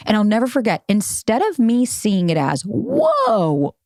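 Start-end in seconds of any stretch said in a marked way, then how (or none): 2.6-3.7 long, high-pitched held whoa; exaggerated warning cry; alarmed whoa. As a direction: draw it out high, like stopping someone short. Voices alarm at red flag.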